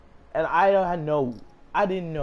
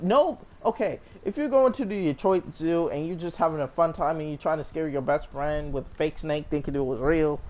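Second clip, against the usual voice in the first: usual speaking voice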